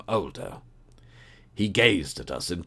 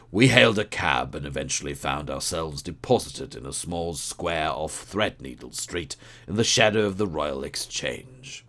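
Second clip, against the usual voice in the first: plain reading voice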